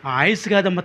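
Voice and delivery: normal talking voice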